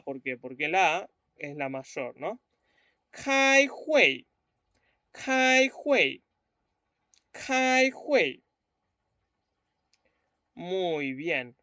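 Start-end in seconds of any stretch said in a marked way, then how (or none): 3.1-8.4 "Kai hui" high and loud, "kai" falling; 示范开会的声调; Mandarin tones. Shown as a demonstration. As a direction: model "Kai hui" loudly for the class. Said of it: "Lo ponemos sobre la A".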